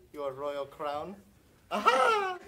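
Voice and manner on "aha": high, loud aha of discovery; triumphant find. Acted. Servant finding the royal crown, loud and delighted. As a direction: pounce on the find.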